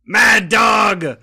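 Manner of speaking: loud pirate shout. Acted. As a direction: bellow the name across the deck. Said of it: "Captain Craddock shouted".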